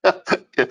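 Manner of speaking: short high laugh; high burst of laughter. Real, not acted. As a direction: laugh out at the joke. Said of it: "I wouldn't be able to get through without laughing".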